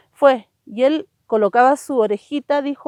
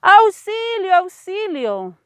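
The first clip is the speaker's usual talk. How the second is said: put-on a girl calling out for rescue; cry for help; 9 semitones higher, slower than usual. A narrator's reading. Girl stuck atop a statue calls for help, high frantic cry. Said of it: "estaba una niña gritando".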